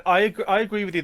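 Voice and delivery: ordinary talk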